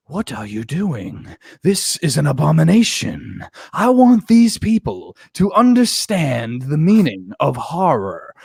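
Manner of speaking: slow, solemn movie-villain speech; hammed-up grave proclamation; mock mob boss. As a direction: imitate a godfather, drawing out each phrase. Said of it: "he's dressed like Vito Corleone".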